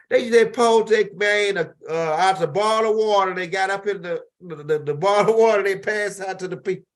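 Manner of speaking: outraged, pushing the story hard; heated rant. Real, not acted. Ranting about the water stunt.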